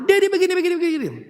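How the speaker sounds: high-pitched mock daughter listing complaints; daughter complaining. Preacher imitates daughter complaining about her dad, high-pitched. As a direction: imitate the daughter rattling off faults. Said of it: "tiga-tiga lagi bicara sama-sama".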